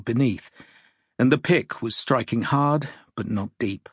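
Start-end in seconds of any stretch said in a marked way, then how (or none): none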